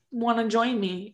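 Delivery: ordinary talk, nothing marked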